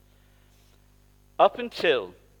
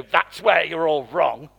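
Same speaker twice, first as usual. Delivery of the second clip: slow, louder, smug correction; mock know-it-all; 4 semitones higher, 7 dB louder, slower than usual. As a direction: lecture them like a know-it-all. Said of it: "I'm not gonna go".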